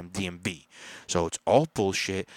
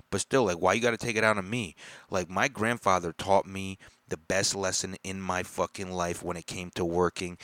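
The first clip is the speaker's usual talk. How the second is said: ordinary talk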